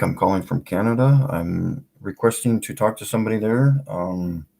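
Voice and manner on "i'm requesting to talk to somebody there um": usual speaking voice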